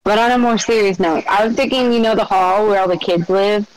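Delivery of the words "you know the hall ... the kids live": normal talking voice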